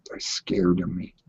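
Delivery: low, hushed whisper. Real, not acted. Streamer confides to viewers that they fear him, low whisper. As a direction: let it drop to a secret whisper. Confiding about his critics.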